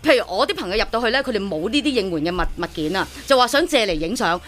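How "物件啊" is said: usual speaking voice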